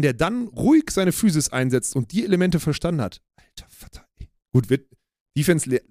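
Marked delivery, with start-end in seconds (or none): 3.4-4.3 whispered. Real, not acted. Awed exclamation about player.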